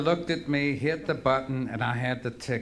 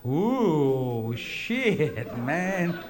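drawn-out, swooping "ooh"; hip chick; 3 semitones higher, much slower than usual. Retold or imitated. Hip security woman looking at it, drawn-out reaction. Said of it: "she goes".

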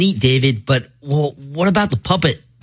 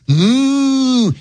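high moo; 10 semitones higher than usual. Acted. Song cow moo.

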